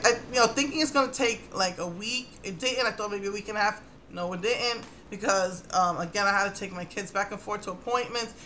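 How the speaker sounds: ordinary talk, nothing marked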